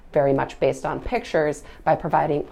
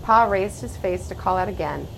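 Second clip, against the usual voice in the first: put-on gentle, hushed read-aloud of a children's story; story narration; 4 dB louder than usual. A narrator's reading. Reads a picture book aloud.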